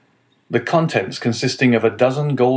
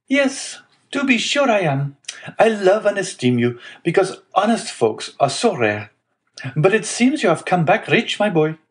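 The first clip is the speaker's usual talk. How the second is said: hearty, wheedling neighbor in a high voice; Caderousse; 10 semitones higher than usual. A narrator's reading. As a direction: play the effusive tailor, pitched well up. Figure of visiting neighbor.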